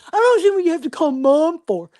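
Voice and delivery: quick, high mock complaint; imitated bickering. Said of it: "And now they start fighting".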